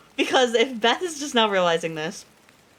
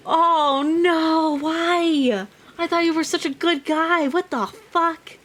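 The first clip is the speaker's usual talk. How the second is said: high, pained cry of betrayal; upset disbelief; 9 semitones higher, 4 dB louder, slower than usual. Real, not acted. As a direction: plead with the book.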